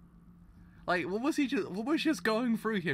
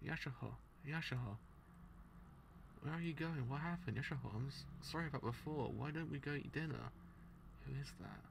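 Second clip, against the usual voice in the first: manga read aloud; 15 dB quieter than usual. Acted. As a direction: read the panels quietly, half acting them. Reads manga aloud voicing characters.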